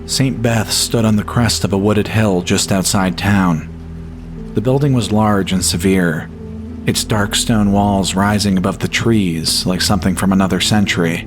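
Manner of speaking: usual speaking voice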